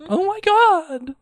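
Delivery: very high, drawn-out squeal; mock freak-out. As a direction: act out a fan's slow squeal. Acting out the listener freaking out.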